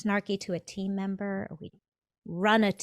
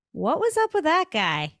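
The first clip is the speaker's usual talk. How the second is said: put-on puzzled young fish; 9 semitones higher, 7 dB louder than usual.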